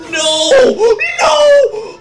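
a desperate shriek of no; real panicked cry. Real, not acted. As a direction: cry out to save the clone. Clone about to die.

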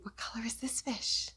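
hushed curious whisper. Shown as a demonstration. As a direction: whisper it as a secret. Secretive whisper to kids.

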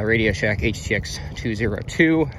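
usual speaking voice

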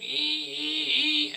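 high, drawn-out squealing "ee"; imitated tyre squeal. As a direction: squeal like tyres on a film police car. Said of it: "squeal like the American police cars".

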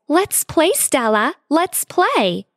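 put-on high, singsong child calling to play; a high childish chant; annoying little sister voice. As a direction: bounce it up like an excited little kid. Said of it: "exclaimed in her annoying voice".

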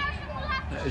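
usual speaking voice

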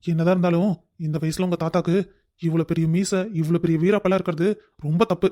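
usual speaking voice

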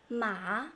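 "Ma" rising from low; second tone. Shown as a demonstration. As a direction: lift "Ma" upward like a question. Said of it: "Second tone".

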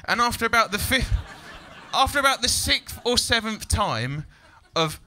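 ordinary talk

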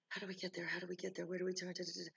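soft, mildly anxious muttered questions; nervous repeated asking. Acted. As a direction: keep fretting under your breath. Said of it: "you're very nervous, and you keep saying".

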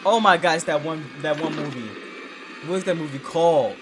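loud, eager recognition; blurting out names as it comes back; excited outburst. Real, not acted. Fan recognizing the show on screen, loud and excited.

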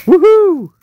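cheering whoop. Real, not acted. Celebrating a chase pull.

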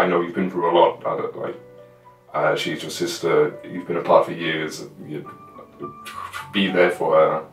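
usual speaking voice

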